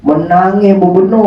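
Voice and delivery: usual speaking voice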